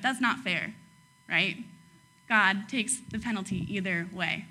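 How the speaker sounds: normal talking voice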